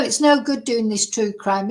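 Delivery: ordinary talk, nothing marked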